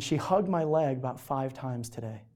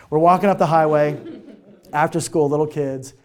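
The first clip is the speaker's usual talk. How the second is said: laughing; 7 dB louder than usual. Real, not acted. Repeats the line, laughing.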